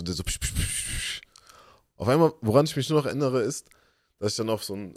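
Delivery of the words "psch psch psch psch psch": quick hissed shushes; repeated shushing. Retold or imitated. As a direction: imitate a dad hushing a child, voiceless. Father shushes the boy. (hastiges Pscht-Zischen)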